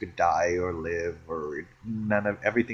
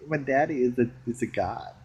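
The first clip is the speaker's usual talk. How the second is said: normal talking voice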